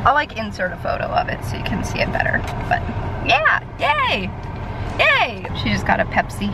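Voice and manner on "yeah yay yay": high cheering yay; excited cheer. Real, not acted.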